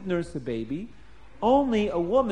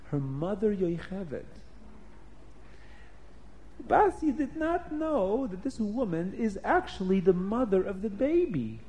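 usual speaking voice

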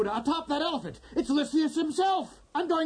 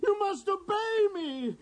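normal talking voice

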